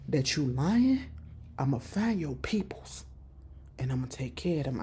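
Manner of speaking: quiet menace to a captive at gunpoint; quiet threat. Retold or imitated. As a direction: threaten low and close. Juke threatens with gun.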